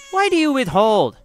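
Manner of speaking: high, stern midwife's demand; accusing. A narrator's reading. Midwife accuses the wet nurse.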